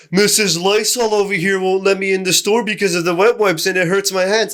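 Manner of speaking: mocking whiny customer. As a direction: parody the complaining customer. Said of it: "And then he goes in".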